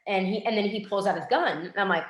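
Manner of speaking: ordinary talk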